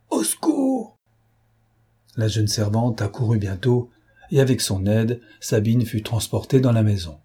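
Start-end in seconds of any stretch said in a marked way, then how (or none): 0.1-1.0 very high, thin cry for help; alarmed cry. A narrator's reading. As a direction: call out in high-pitched panic. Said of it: "cria la gouvernante".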